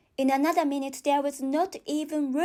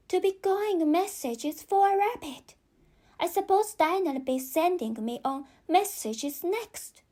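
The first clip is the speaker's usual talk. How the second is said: put-on a higher, girlish voice, bemused; Alice's voice; 4 semitones higher than usual. Alice grumbles about running rabbit errands, girlish. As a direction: sound like a curious little girl.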